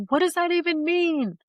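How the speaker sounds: high, baffled question; incredulous. Retold or imitated. Mother retelling her therapist session, asks high and baffled. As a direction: pitch it way up in total bewilderment. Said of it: "And I'm like".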